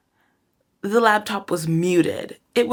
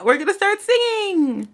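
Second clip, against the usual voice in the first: excited; 12 semitones higher, 4 dB louder than usual. Real, not acted. About to sing.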